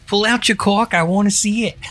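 high mock woman in a Massachusetts accent; hammed-up Massachusetts come-on. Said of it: "she's got that accent".

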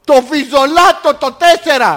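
slow, loud, high enunciation; loud comic insistence. Pretend. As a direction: hammer each word out for the machine. Caller repeating loudly to phone system.

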